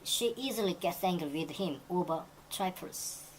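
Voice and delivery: very slow, careful textbook read; slow example reading. Shown as a demonstration. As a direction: lay out every word slowly for learners. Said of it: "teacher reads example sentence". (천천히 읽는 예문)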